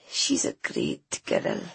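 weak, slow, hushed; frail low whisper. A narrator's reading. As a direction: murmur with love through pain. Sick mother's frail voice.

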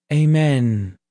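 plain reading voice

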